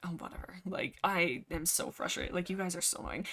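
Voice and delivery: breathy sarcastic whisper, fed up and dismissive. Retold or imitated. Crowpaw brushing off the warriors, whispered and sarcastic. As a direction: hiss it like a bored, annoyed apprentice. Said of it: "sly little quips, sassy, sarcastic".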